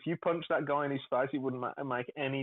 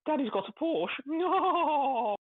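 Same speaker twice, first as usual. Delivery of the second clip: very high mock voice, long drawn-out no; put-on silly voice; 13 semitones higher, 4 dB louder, much slower than usual. Host joking about Daddy's Porsche, put-on silly voice. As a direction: squeal it in a put-on comic voice.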